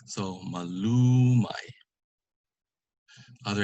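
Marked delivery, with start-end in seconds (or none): 0.5-1.7 slow MALUMAY, LU held long and loud; penultimate stress. Shown as a demonstration. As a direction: draw out LU in MALUMAY. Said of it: "an example of the word itself".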